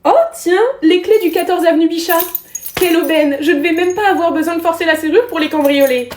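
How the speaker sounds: high, delighted villain voice; lightly hammed-up burglar glee; gleeful burglar. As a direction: gloat over the lucky find like a cartoon thief. Burglar finds keys.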